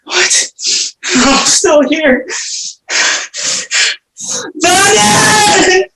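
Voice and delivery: high, half-laughing manic shouting; manic raving. Acted. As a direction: rave and cackle at the demons. Orestes drives away the Furies.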